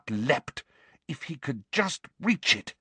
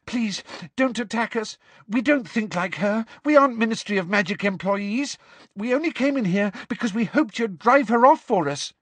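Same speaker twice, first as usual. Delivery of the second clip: high, urgent, breathless pleading; Hermione, breathless; 10 semitones higher than usual. Acted. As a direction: plead desperately in a raised-pitch voice. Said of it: "said Hermione breathlessly".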